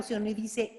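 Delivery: usual speaking voice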